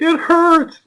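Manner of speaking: put-on high, loud toddler wail; whining toddler. Father imitating his son refusing the potty, high wail. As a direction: protest like a small boy on the potty. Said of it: "be like".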